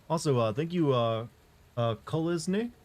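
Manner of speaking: ordinary talk, nothing marked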